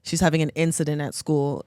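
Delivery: ordinary talk, nothing marked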